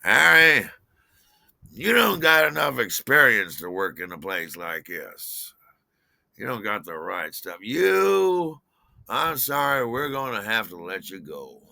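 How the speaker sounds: loud opening, easing down into false sympathy; poisonous boss. Retold or imitated. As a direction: play the boss firing someone. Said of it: "he poisonously put his arm around me".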